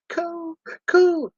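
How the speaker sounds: high-pitched wail of the name; hammed-up high, sorrowful cry; mock crying kid. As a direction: imitate a tearful child calling out. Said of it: "he's crying his tears out".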